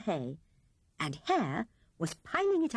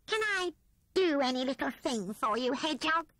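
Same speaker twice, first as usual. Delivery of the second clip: a hint of eager squeak; lightly voiced Rat; 7 semitones higher than usual. A narrator's reading. Rat eagerly offering help to Hedgehog, lightly voiced. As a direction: offer help a little higher.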